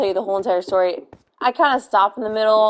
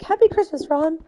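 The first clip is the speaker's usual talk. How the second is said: high-pitched quoted greeting; movie character line; 6 semitones higher than usual. Retold or imitated. Retelling a Harry Potter dubbing scene, high imitation. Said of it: "the Christmas scene where he's like".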